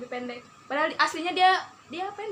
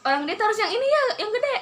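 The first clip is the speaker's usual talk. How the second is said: laughing; 9 semitones higher, 3 dB louder than usual. Real, not acted. Teasing complaint, laughing.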